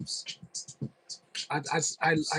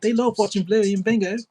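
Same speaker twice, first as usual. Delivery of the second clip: cheery mock Aussie parent; Australian accent; 6 semitones higher, 6 dB louder than usual. Shown as a demonstration. Streamer keeps his hammed Australian accent for chat. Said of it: "That's Australian".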